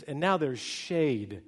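normal talking voice

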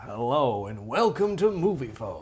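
slow, smooth phone-line announcer; Movie Phone announcer voice. Retold or imitated. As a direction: read it like the automated Movie Phone greeting. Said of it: "it would go".

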